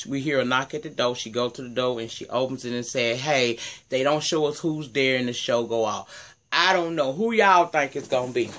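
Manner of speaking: normal talking voice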